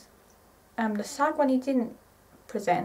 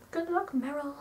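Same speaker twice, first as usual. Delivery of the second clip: fond wish; 5 semitones higher, 5 dB quieter than usual. Real, not acted. Fan signing off to Meryl.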